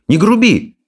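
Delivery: high sharp rebuke; indignant. Acted. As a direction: tell him off, offended. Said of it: "возмутился Икинг". (возмущённый упрёк)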